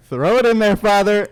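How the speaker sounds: high, loud announcer call; PA announcer cheering the priest, high and loud. Retold or imitated. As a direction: boom it out like a ballpark PA. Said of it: "And he's like".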